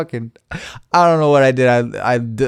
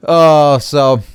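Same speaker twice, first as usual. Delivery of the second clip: ordinary talk, nothing marked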